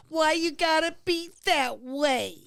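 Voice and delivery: very high, drawn-out protesting voice; hammed-up high-pitched objection; Beth's mock complaint. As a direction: mimic a partner complaining, slow and high. Acts out Beth's complaint to him.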